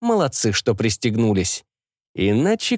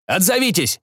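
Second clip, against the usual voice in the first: calling out; 8 semitones higher than usual. Acted. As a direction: shout to them across the ship. Commander shouts to the cabin.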